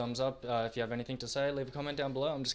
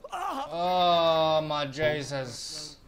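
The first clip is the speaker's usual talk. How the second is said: long, drawn-out cry of despair, louder and higher; anguished wail; 5 semitones higher, 8 dB louder, much slower than usual. Real, not acted. Gamer wailing after losing his care package, anguished drawn-out cry. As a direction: wail it slowly like it physically hurts.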